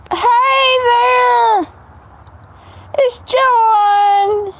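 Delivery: high bright calling out; excited greeting. Real, not acted. Greeting a live chat viewer, high excited call.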